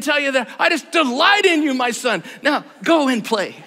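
overdone loving father, half crying; mock tender dad. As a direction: pour on the love, teasing. Dad's surprise tender speech to son.

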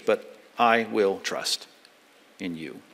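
slow, sinking to almost nothing on "in you"; slow, softening to a hush; quiet trust. Real, not acted. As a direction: let it settle into a hush. Closing verse, quiet resolve.

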